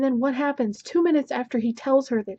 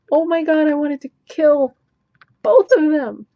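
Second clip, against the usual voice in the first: louder, higher, real anger; furious outburst; 5 semitones higher, 7 dB louder than usual. Real, not acted. Reviewer rages at the book's lovers, loud fury. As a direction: let the fury burst out.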